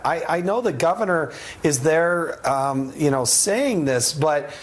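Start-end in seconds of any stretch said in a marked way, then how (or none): none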